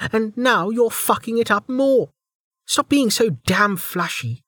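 angry woman. Acted. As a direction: vent her frustration. Velika's angry rant.

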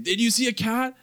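high-pitched urgent question; anxious questioning. Retold or imitated. Cat sitter asking the neighbor about the lost cat, anxious and high. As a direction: ask it high, with a touch of panic.

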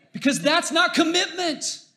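high, loud outburst from the pulpit; emphatic preacher's shout. Real, not acted. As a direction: shout the point home at a high pitch. Preacher drives home his point.

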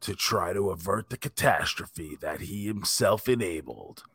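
in a world, deep and grave; movie trailer voice. Retold or imitated. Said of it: "it was like the movie trailer voice".

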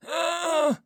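very high, loud yelp of pain; fleeing soldiers' shrill scream of pain. A narrator's reading. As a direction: scream as you run off. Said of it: "screams the enemy as they flee".

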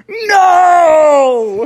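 high, loud, drawn-out wail; anguished shout. Acted. Smee realizing he has lost the game, anguished shout. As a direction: cry out in melodramatic defeat. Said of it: "And Smee shouted".

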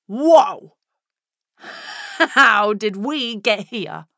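loud startled whoa then slow; shocked whoa. Acted. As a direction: recoil in shock, then wonder. Shocked at abortion topic.

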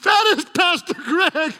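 high, loud mock wail; hammed-up tearful kid's shout; crying little kid. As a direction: wail to Daddy like a frightened child. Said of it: "he started crying".

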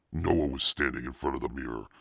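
ordinary delivery

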